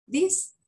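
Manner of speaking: short, high, rising THIS; short-vowel this. Shown as a demonstration. Teacher setting up the difference for students, short rising "this". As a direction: lift "this" high with a brief i. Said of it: "La diferencia".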